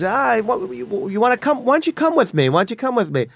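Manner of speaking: high-pitched, insistent invitation; coaxing stranger. Retold or imitated. Stranger inviting a passerby into his van, high eager coaxing. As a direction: coax like an excited street recruiter. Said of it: "He goes".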